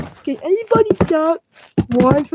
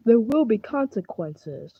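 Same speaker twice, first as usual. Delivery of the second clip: softer, low ominous repeat; 5 semitones lower, 10 dB quieter than usual. Acted. Repeating the threat to the other player, quietly ominous. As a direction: say it again, dark and quiet. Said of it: "you had to say it, like, three times".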